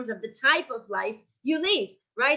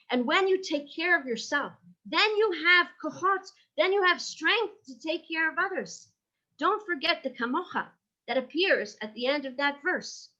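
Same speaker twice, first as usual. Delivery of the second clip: ordinary talk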